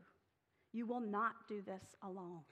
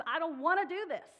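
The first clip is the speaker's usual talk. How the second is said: put-on higher, louder childish whine; whiny refusal; 6 semitones higher, 9 dB louder than usual. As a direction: sulk like a reluctant kid. Preacher paraphrases Moses whining.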